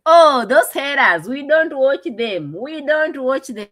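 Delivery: mocking quote. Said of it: "but they say".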